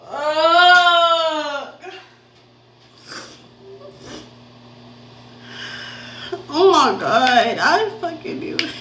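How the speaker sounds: high, loud half-laughing disbelief; a breaking shocked outburst; stunned disbelief. Real, not acted. Reacting to positive test.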